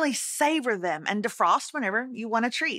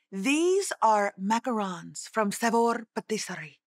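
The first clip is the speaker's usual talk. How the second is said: slow, showy French accent; slower than usual. Shown as a demonstration. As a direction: roll it out in a posh French accent. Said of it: "I even said it with a French accent".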